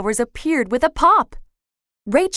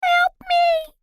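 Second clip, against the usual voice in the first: tiny high cry for help; tiny fairy voice. A narrator's reading. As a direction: call out small and desperate from inside. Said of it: "it called".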